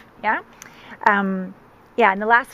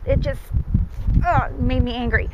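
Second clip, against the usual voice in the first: ordinary talk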